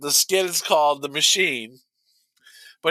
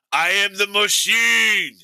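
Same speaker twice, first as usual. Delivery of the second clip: mock-heroic shout, higher and drawn out; booming boast; 4 semitones higher, 5 dB louder, slower than usual. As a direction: bellow it slowly like a big proclamation. Retold boast to Russian mafia.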